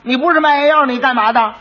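demanding magistrate. Acted. Magistrate demanding what the quack really does, sharp. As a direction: press him for an answer.

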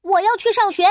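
put-on high, loud, determined child announcement; announcing. A narrator's reading. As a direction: declare it proudly at full voice. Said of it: "他向爸爸妈妈宣布".

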